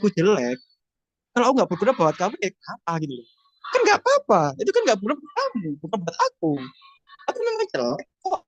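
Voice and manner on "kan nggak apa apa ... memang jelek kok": defiant reply. Acted. Acting out confident affirmation reply.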